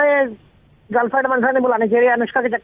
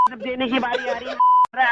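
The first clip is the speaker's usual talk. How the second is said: ordinary talk, nothing marked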